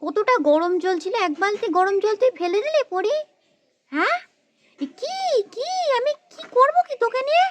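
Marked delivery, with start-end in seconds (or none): 3.9-7.5 high-pitched, aghast scolding; outraged squawk at a naughty child; exasperated mother. Acted. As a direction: throw the words out in exasperation. Mother at wits' end with child.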